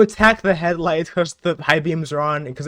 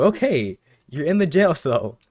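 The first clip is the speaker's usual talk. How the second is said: usual speaking voice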